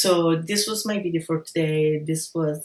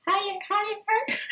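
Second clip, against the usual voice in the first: squeaky falsetto climbing way up; very high falsetto. Shown as a demonstration. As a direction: push the pitch as high as it goes. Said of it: "you can go even higher".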